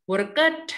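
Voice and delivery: "worked" slow, as two syllables, wur-ked; wrong extra syllable. Shown as a demonstration. Teacher showing the misreading, slow two-syllable "worked". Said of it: "bukan worked".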